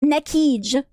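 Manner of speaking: "Nekij" said as NECK-eej, ending in a j; mispronounced Nakige. Retold or imitated. Streamer quotes the YouTuber's blunder, "Nekij" mispronounced as NECK-eej. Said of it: "And he says".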